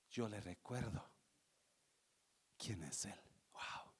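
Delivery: hushed, breathy whisper of awe; awed whisper. Acted. Preacher to congregation about God, awed whisper. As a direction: drop to a whisper in wonder.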